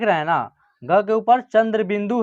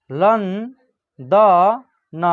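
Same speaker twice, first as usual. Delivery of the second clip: London very slowly, syllable by syllable; slow dictation of London; broken into syllables; much slower than usual. Shown as a demonstration. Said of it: "तोड़ के पढ़िएगा".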